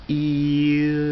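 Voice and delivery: continuous vowel slide on "Ew"; vowel glide demo. Shown as a demonstration. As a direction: stretch "Ew" out, gliding slowly from vowel to vowel. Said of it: "listen to this".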